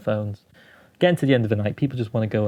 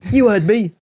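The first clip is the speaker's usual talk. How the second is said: high, raised-voice heckle; imitated heckler; 9 semitones higher, 4 dB louder than usual. Heckler answering the puzzled comic, high mock shout. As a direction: call it at the stage like a heckler.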